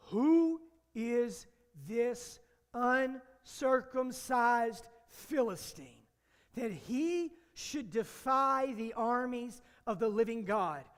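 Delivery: loud, slow, drawn-out proclamation; preacher booming David's defiance of the Philistine; preacher declaiming. Acted. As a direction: proclaim it like a battle cry from scripture.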